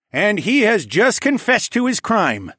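loud, slow, booming royal voice; booming king. A narrator's reading. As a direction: boom it out like a king. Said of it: "Tecumseh said in a booming voice".

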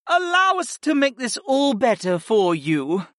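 high-pitched succubus voice; smooth murmuring succubus. A narrator's reading. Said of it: "Viopa murmured".

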